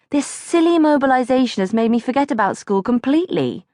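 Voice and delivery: put-on a girl dragged from bed at night, bewildered and scared; high startled child calling to Mummy; frightened little girl. Girl woken for air raid.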